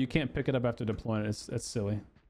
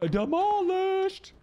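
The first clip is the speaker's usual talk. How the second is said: long, very high stretched word; drawn-out squeal; 3 dB louder, much slower than usual. Real, not acted. Stretched exclamation.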